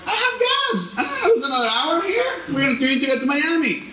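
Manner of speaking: high-pitched mock protest. Driver objecting to God's order to get off, protesting. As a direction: argue back in a high, whiny-pleading pitch.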